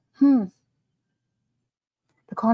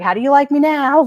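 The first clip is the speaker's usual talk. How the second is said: a higher, louder, smug gloat; smug aunt; 6 semitones higher, 7 dB louder than usual. Retold or imitated. Aunt gloating as mayonnaise prices rise, smug and loud. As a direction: crow over the mayonnaise prices. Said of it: "my aunt was like".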